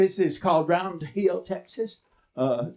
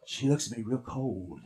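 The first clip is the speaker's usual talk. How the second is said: hushed serious; 8 semitones lower, 9 dB quieter than usual. A narrator's reading. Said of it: "real cold and serious like".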